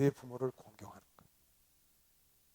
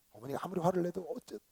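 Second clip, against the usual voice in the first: ordinary talk, nothing marked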